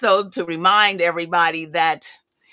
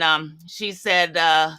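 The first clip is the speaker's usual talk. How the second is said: ordinary talk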